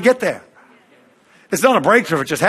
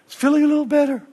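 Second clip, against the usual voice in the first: higher, lightly voiced relieved girl; 9 semitones higher, faster than usual. Girl on stage says the pain is fading, lightly voiced. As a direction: ease off the pain as it fades. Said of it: "she go".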